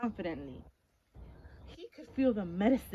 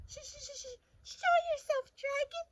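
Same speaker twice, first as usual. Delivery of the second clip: very high, stuttering frightened squeak; stammering scared knight. Acted. Frightened knight calling the dragon out of the cave. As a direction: stutter it out trembling. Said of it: "thin, shiny knight".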